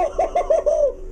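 high gleeful laugh; excited laugh. Retold or imitated. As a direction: laugh like a thrilled fan. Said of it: "I get excited about the stupid Bears".

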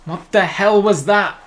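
loud, high, slow disbelieving cry; stunned drawn-out what the hell; shocked outburst. Real, not acted. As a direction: react to the shock ending. Shocked at the sudden elimination.

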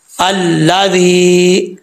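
अल्लाज़ी with the final ee held long; long drawn vowel. Shown as a demonstration. Quran teacher showing how long to stretch the vowel, drawn-out recitation. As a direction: draw out the ee of अल्लाज़ी slowly. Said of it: "एक अलिफ की मिकदार लंबा करेंगे".

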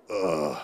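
low, weary groan at the alarm; mock groggy ugh; morning groan. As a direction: groan like you don't want to get up. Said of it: "is what you think".

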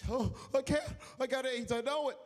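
high, loud frantic voice; hammed-up panic, high and loud; mock panic. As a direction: cry out you're doomed. Scared victim panics.

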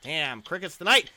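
ordinary talk, nothing marked

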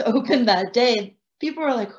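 usual speaking voice

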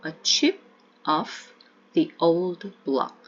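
put-on slow, each word apart: "a chip of the old block"; "a chip of the old block" said slowly with gaps. Shown as a demonstration. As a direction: spell it out slowly, unlinked. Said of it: "조금 연음하시면".

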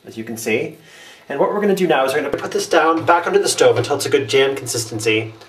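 ordinary talk, nothing marked

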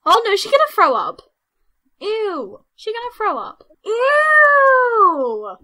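high, squealing disgust; grossed out. Real, not acted. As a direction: cry out in revulsion. Disgusted she may vomit.

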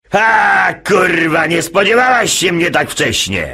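high triumphant shout. Acted. Jasiu kicking the door open, high triumphant shout. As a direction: burst in yelling. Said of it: "Do sali wskakuje Jasio i krzyczy".

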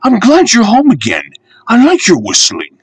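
put-on a keen young engine cheering a friend; Percy, high and eager. A narrator's reading. Percy comforting Henry.

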